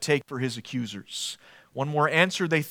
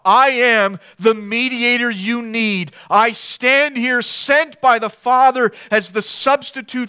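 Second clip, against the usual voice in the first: Jesus's bold declaration; 7 semitones higher, 7 dB louder than usual. Retold or imitated. Preacher voicing Jesus before the Sanhedrin, bold. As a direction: proclaim it plainly. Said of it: "he says".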